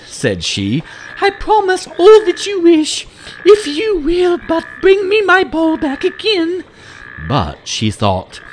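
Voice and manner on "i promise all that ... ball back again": put-on very high, loud girlish princess; young princess voice. Princess surprised to find the frog speaking, very high girlish voice. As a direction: squeak it out like a startled little princess.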